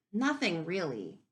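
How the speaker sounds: plain reading voice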